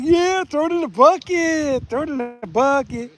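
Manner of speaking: high, slowed, hammed-up glee; excited quote. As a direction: gleefully act out the catch. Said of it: "I was like".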